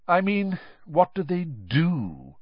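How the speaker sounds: cold disdain, stretched at the end. A narrator's reading. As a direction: drag out the challenge coldly. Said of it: "said a cold voice".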